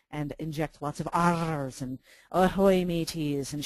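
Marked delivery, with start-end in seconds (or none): 1.1-1.8 loud, drawn-out pirate "arr"; lightly voiced pirate growl of "arr"; half-hearted pirate. Shown as a demonstration. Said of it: "talk like a pirate all day long". 2.3-3.5 loud mock pirate greeting; half-hearted pirate. Shown as a demonstration. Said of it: "talk like a pirate all day long".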